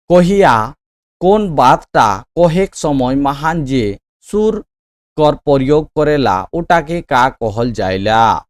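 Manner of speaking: slow, emphatic reading aloud; measured, projected classroom dictation; quiz question read-out. Real, not acted. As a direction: read the question out slowly and firmly. Reads exam question aloud.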